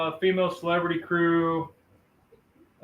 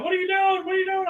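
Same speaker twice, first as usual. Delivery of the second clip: mock panicked, much higher pitch; freaking out; 13 semitones higher, much faster than usual. Friend's wife panicking at the funnel cloud, high mock panic. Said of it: "his wife was, like, freaking out".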